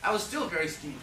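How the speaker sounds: ordinary delivery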